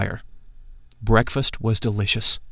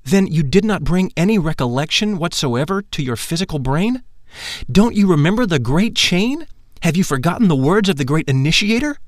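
higher, pressing mentor voice; a mildly higher insistent teacher; Lytolantes voiced; 6 semitones higher than usual. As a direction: urge him to remember. Lytolantes questions him.

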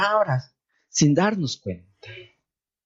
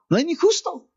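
No hay ni justo pitched higher, stress on ni; emphatic declaration; 9 semitones higher than usual. Acted. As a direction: proclaim it up high. Preacher's emphatic scripture line.

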